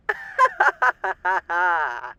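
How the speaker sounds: loud gleeful cackle. Acted. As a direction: cackle with showman delight. After "fun for the whole family.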